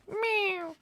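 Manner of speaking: high, soft mewing; cat meow. Retold or imitated. Reader imitating the cat entering, high soft meow. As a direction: mew like a cat.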